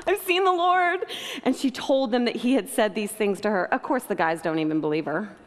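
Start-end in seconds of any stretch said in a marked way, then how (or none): 0.1-1.1 high, ringing excited news; breathless-with-joy exclamation, pitched up; elated announcement. Acted. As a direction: proclaim it high and overjoyed. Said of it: "went to the disciples with the news".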